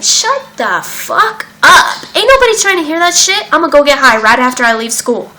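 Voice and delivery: high, loud, mocking teen brush-off; hammed-up defiant teenager in class; bratty teen sass. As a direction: sneer at the speaker like a bored high schooler. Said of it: "I was like".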